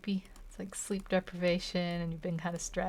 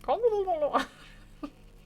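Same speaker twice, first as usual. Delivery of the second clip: a sudden high-pitched laugh; high burst of laughter; 11 semitones higher, 6 dB louder than usual. Real, not acted. Drawing streamer cracking up after flubbing her improv line, high laugh. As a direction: break into giggles mid-scene.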